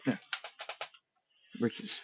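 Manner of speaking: ordinary delivery, nothing marked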